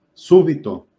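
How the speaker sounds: súbito with SÚ punched high and loud; stress on first syllable. Shown as a demonstration. Teacher giving an example of an esdrújula, SÚ stressed hard. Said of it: "va acentuada porque es esdrújula".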